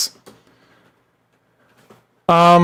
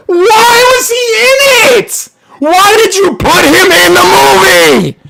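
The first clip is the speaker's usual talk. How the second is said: slow, high exasperated yell; throwing the question out in fury; exasperated shouting; 10 semitones higher, 3 dB louder, slower than usual. Real, not acted. Outraged over Bill Murray's cameo.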